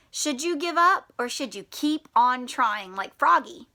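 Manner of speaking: usual speaking voice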